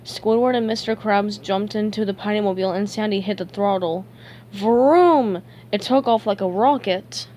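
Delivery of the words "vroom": loud drawn-out vroom; a big vroom, louder than the narration; engine vroom. A narrator's reading. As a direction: imitate an engine roaring off. Narrator imitates rocket engine.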